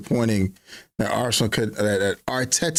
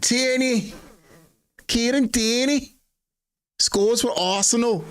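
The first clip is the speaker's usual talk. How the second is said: Scottish commentator; 11 semitones higher than usual. Pretend. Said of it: "What kind of Scottish accent is that?".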